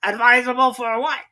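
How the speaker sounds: high, loud duck voice. Acted. Duck interrupting the Mouse, high and loud. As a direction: ask it like a puzzled duck. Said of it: "said the Duck".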